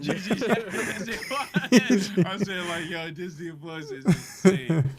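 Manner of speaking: real laughter breaking up the words, high-pitched; laughing while talking. Real, not acted. Host cracking up with co-hosts over Disney Plus, laughing through the words.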